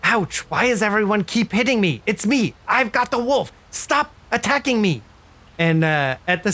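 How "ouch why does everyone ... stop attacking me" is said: much higher, aggrieved and pleading; the hidden voice again, high and put-upon; whiny voice from Miller. Acted. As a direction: complain like you're the one being picked on. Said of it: "you hear a voice coming from Miller again".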